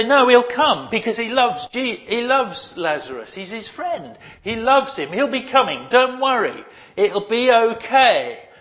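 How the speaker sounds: high, loud, pleading insistence; insistent reassurance. Acted. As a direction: insist loudly that all will be fine. Acting sisters insisting Jesus will come.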